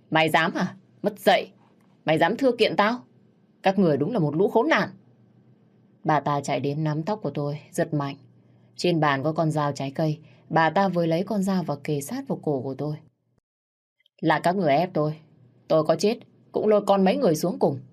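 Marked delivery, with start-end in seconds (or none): 0.1-4.9 higher, louder furious tirade; furious shout. Acted. Mother-in-law raging at daughter-in-law. 14.2-17.8 rising, louder, faster menace; desperate threat. Acted. Knife at throat, threatening.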